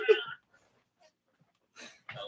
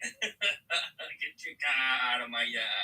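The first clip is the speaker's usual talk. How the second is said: very slow, drawn-out Boston accent; much slower than usual. Shown as a demonstration. Repeating the requested line for the stream, slow Boston accent. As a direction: stretch the R-less Boston vowels after a laugh. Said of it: "say in my Boston accent".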